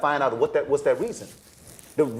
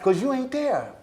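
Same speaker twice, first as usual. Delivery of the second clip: higher, incredulous dismissal; mock exasperated director; 8 semitones higher than usual. Director sending an auditioning actor away, incredulous. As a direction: shoo the actor out, half amused. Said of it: "And I'm like".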